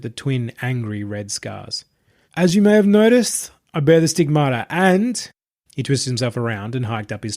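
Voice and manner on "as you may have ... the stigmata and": higher, calm, self-satisfied preacher voice; smug self-proclaimed messiah. Acted. Possessed streamer addressing his entourage, smug and serene. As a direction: announce your divinity like a smooth cult leader. Marius shows his wounds.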